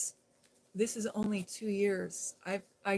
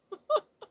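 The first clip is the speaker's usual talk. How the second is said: a sudden high laugh; high burst of laughter; 13 semitones higher, 6 dB louder than usual. Real, not acted. After the daughter's teasing line, high laugh.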